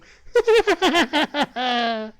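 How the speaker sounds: put-on high eerie little girl laugh; a ghost girl giggling delightedly; creepy child giggle. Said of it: "you're awesomely creepy".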